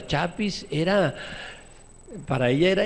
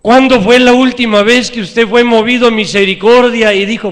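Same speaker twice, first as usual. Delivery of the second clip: loud, higher rhetorical question; preaching at full force; 7 semitones higher, 13 dB louder than usual. Acted. Preacher challenging the congregation, loud preaching.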